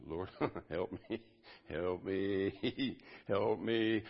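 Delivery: half-laughing plea for help; lightly acted panic with a chuckle; mock nervous pleading. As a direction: stretch out a small, wobbly-legged prayer. Acts out nervously walking on water.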